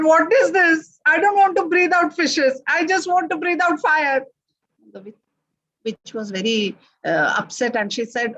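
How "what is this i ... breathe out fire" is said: complaining dragon. A narrator's reading.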